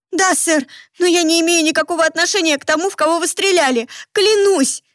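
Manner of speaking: high, fast, terrified babbling; frantic pleading. Acted. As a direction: swear innocence in panic. Girl begs at gunpoint.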